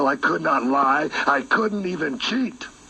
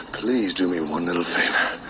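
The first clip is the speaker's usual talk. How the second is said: low, whispery plea; hushed, menacing pleading; sinister begging; 9 semitones lower than usual. Acted. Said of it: "I'm begging you".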